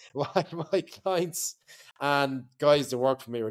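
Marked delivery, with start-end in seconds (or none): 0.0-1.6 breaks into laughter mid-phrase, higher and slower; laughing through words. Real, not acted. Laughing while admitting he snorted coke in the office.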